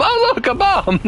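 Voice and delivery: high, playful falsetto repeat; squeaky mock voice again. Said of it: "Is that a bomb?".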